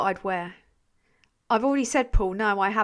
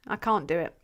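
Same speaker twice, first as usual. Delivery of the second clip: usual speaking voice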